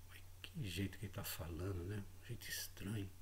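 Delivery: a hushed, baffled aside; a private whisper of disbelief. Retold or imitated. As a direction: whisper it to yourself, puzzled. Puzzled inner thought, aside.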